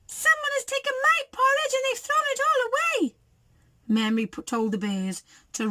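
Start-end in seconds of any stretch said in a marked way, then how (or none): 0.1-3.2 very high, wailing little bear; wee little voice. A narrator's reading. Baby Bear discovers the mess, wee crying voice. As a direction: cry it out in a tiny, high voice. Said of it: "cried in his wee little voice".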